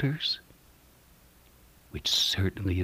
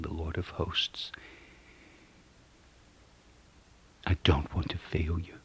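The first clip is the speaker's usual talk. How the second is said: soft, breathless whispered plea; hushed earnest whisper; whispered prayer; 3 semitones lower, 8 dB quieter than usual. Real, not acted.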